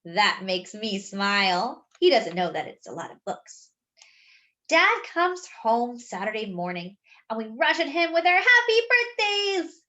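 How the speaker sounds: plain reading voice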